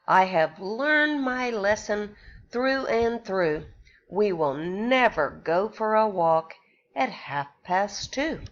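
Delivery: ordinary delivery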